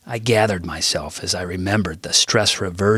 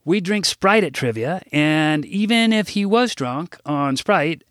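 higher-pitched addressing an assembly; formal speech; 8 semitones higher than usual. Acted. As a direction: speak up to a hall of kids, a touch showy. Gives a school assembly speech.

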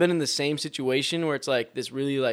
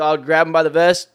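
loud, punchy action beats; emphatic; 3 semitones higher, 8 dB louder than usual. Real, not acted. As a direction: hammer each action word. Describing rescue energetically.

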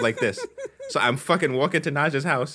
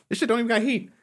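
exasperated rant; 10 semitones higher, faster than usual. Real, not acted. Ranting about the old car.

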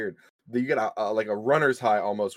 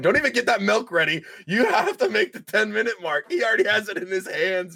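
teasing hype to stream viewers; excited hype; 9 semitones higher than usual. Real, not acted. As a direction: hype the chat up. Hyping stream audience, laughing.

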